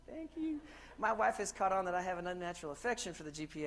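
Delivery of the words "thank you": high, gushing thank you; swooning thanks. Retold or imitated. Driver thanking GPS lovingly, high gushing coo. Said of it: "I'm like".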